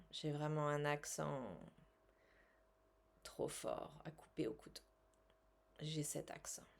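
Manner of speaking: calm, measured guided-relaxation voice; soothing self-help guide voice; guided tapping session. Acted. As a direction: lead them gently through the tapping.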